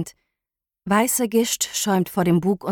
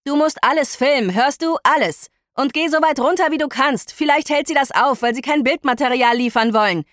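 urgent command; 6 semitones higher than usual. Acted. Elvar urges Ari to film.

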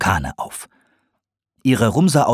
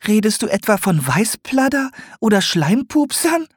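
much higher, stunned owl question; aghast; 14 semitones higher than usual. A narrator's reading. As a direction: ask in horrified disbelief. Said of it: "fragte Gwyneth fassungslos".

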